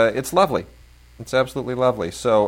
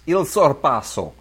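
Italian accent; 6 semitones higher, 4 dB louder than usual. Shown as a demonstration. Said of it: "I love when you say that with a French accent".

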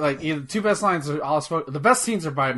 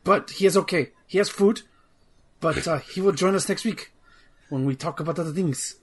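bad French accent; 3 semitones higher than usual. [name] pretending to be French [name] hijacking the show, hammy French accent. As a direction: play a cartoonish Frenchman taking over the show. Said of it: "a bad French accent".

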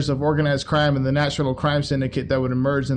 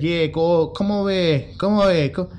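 higher, lightly voiced boss showing off the poster; imitating Capone; 4 semitones higher than usual. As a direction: wave the new hire over like a smug boss. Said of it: "He's like".

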